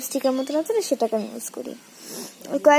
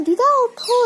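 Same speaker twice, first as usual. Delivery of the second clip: urgent call; 9 semitones higher than usual. Retold or imitated. Retold alarm to grandmother.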